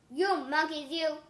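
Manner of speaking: loud, angry demand; furious peddler yells up at the monkeys; yelled. A narrator's reading. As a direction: yell at the monkeys in a temper. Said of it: "stomped his feet and yelled".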